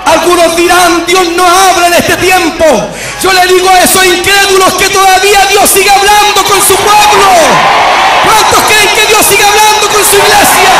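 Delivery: high, driving preacher's proclamation; full-force preaching. Real, not acted. Preacher challenging unbelievers before the church, full-force preaching. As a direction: build to a high rallying cry for the congregation.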